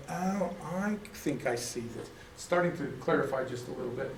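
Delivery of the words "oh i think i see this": soft, slow aha murmured to himself; quiet dawning realization. Retold or imitated. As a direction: let it dawn slowly and softly. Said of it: "I'm starting to go".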